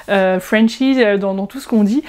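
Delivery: ordinary delivery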